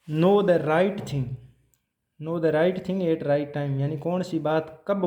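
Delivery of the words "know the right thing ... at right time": very slow, word by word, for students to write; slow dictation. Shown as a demonstration. Teacher dictating a principle to the class, slow and deliberate. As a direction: spell it out slowly so they can copy. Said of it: "teacher repeats phrase for notes".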